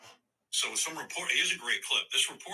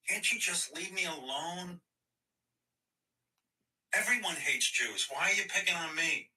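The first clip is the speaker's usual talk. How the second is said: mocking whiny Kyrie; 9 semitones higher than usual. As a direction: whine like a spoiled brat caught out. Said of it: "he's just a fucking moron".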